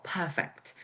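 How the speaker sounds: whispered under the breath; whispered thought. Retold or imitated. Reading the lonely hearts ad, she whispers to herself. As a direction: breathe it to yourself, pleased.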